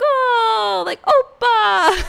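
high, loud, drawn-out squeal; hammed-up shriek of delighted surprise; mock excited surprise. Vlogger mimicking everyone when Peter's family shows up, mock excited. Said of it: "so excited and so surprised".

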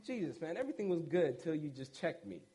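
low, soft teasing complaint to Jesus; mild mock gripe. Disciple ribbing Jesus after a heavy talk, low mock grumble. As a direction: grumble it like a friend who got called out.

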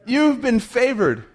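high, slow, emphatic announcement; angel's proclamation. Acted. Angel tells Mary she is favored, high proclamation.

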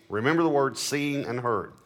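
ordinary talk, nothing marked

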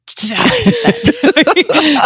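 loud, high burst of laughter. Real, not acted. Laughing at herself in story.

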